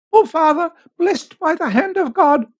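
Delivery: put-on high-pitched old woman crying out; old woman's cry. A narrator's reading. Old woman on her knees to the priest, high pleading cry. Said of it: "cried an old woman".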